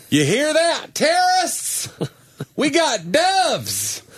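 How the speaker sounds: high, hammed-up challenge, last words slow; mock patriotic taunt. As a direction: taunt the terrorists in a silly proud voice. Mock patriotic announcer taunting terrorists.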